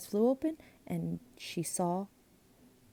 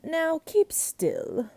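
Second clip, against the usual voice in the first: slow, high stern snap; snapped; 9 semitones higher, 4 dB louder, much slower than usual. A narrator's reading. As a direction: bite it off at her. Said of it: "the glunk snapped back".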